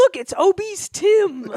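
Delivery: high, slow sing-out; mock storybook voice. Spotting Tim in the Christmas tale, mock storybook voice. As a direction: coo it like a hammed-up Dickens character. Joins the acted Christmas Carol scene.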